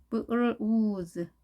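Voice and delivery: "블루즈" slowly broken into b, l, long oo, z; sound-by-sound demo. Shown as a demonstration. Teacher introducing blues to learners, slow sound-by-sound "블루즈". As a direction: draw out each sound of "블루즈". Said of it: "자, 읽어보겠습니다".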